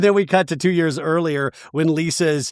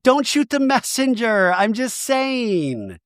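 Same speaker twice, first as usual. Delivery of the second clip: high-pitched put-on innocence, last words drawn out; mock defensive; 7 semitones higher, slower than usual. Imitating a cast member who passed on gossip, mock defensive.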